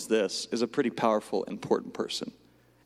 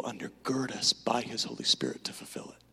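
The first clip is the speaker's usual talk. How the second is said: whisper; 3 semitones lower, 6 dB quieter than usual. Acted. Whispered emphasis.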